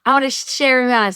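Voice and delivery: eager yes. Retold or imitated. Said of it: "I was like".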